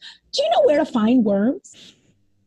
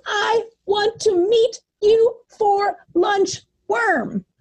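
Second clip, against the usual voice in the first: mock scream; 7 semitones higher, slower than usual. A narrator's reading. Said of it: "the bluebird is screaming down to the hole".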